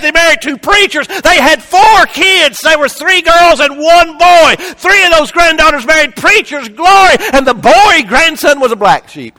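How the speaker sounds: very high, very loud preacher's shout; full-force preaching. Real, not acted.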